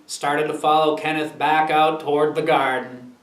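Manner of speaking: ordinary delivery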